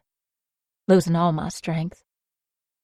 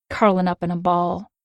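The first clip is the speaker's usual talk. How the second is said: ordinary delivery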